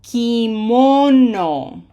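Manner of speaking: "Kimono" very slow, with a loud long "mo"; stress on middle syllable. Shown as a demonstration. Teacher models pronunciation emphasis, slow "Kimono" stressed on "mo". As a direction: stretch and punch "mo" in "Kimono". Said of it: "The highlighted syllable has pronunciation emphasis".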